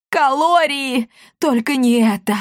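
high, despairing cry; theatrical horror, starting high and easing down; horrified wailing. A narrator's reading. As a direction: wail as if facing disaster. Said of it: "в ужасе закричала она".

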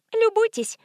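high-pitched, showy invitation; proud nurse. A narrator's reading. Nurse showing visitor the cottage, proud. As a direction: present it proudly in a sweet voice.

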